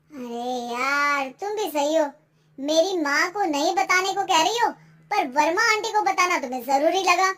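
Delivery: acted marital annoyance, rising in force; annoyed reproach. Husband reproaches wife over telling the neighbour, annoyed.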